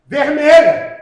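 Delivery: high, loud, punched word; confident class answer. Retold or imitated. Whole class answers the teacher in one voice, confident. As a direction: call it out with total certainty. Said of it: "vocês vão dizer com uma só voz".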